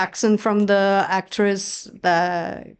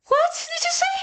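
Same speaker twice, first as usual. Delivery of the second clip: high, far reply; confused. Retold or imitated. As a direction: squeak it out baffled. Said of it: "I got, like".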